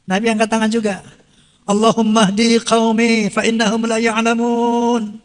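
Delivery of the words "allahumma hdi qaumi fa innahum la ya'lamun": slow, louder, solemn chanted Arabic; Arabic prayer recitation. Acted. The Prophet praying for his people, solemnly chanted. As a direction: intone it like a heartfelt prayer.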